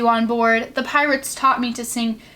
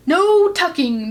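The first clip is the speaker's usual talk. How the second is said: slow, higher crew outcry; crew cry; 6 semitones higher, 4 dB louder, slower than usual. Acted. Crew refusing to tuck the boy in, crying out. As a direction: protest it together in disgust. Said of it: "the crew cried".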